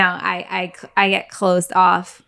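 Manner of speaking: normal talking voice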